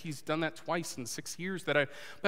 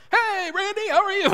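much higher, louder, bright fake cheer; forced cheery greeting; 9 dB louder than usual. Pastor answering a friend's call, putting on a cheery voice. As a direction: sound fine on the phone even though you're not. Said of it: "I'm not screaming at my desk".

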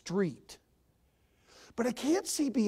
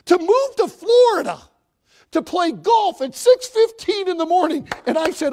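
loud, driving sermon delivery; full-force preaching; 4 semitones higher, 13 dB louder than usual. Acted. Preacher exhorting the congregation to live for more. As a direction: build to a fiery exhortation.

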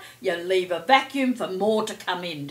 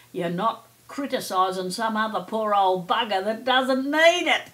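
normal talking voice

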